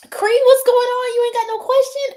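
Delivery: playful loud callout to a chat member; calling out. Real, not acted. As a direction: hail Cree across the room. Calling out to viewer.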